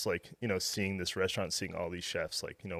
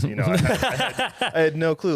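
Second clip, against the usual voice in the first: high, loud, laughing it out; laughing disbelief at himself; 10 semitones higher, 10 dB louder than usual. Real, not acted. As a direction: laugh through the admission of total cluelessness. Laughing at own ignorance.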